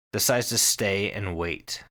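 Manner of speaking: ordinary talk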